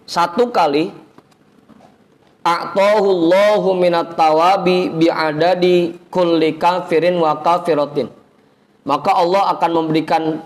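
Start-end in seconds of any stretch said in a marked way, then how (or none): 2.4-8.1 slow, chanted Arabic recital; Arabic recitation. Real, not acted. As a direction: draw out each Arabic word like a reciter. (bacaan hadis berirama pelan)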